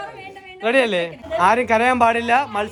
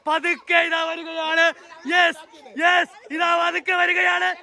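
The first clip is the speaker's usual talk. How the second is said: lively play-by-play, pitched higher; sports commentary; 5 semitones higher than usual. Acted. Commentating the kids' lemon-and-spoon race, lively play-by-play.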